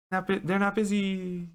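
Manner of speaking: high, thin call carried on the breeze; faint high-pitched words from afar; voice on the wind. Acted. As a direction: echo it high, drifting on the wind. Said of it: "sort of on the wind".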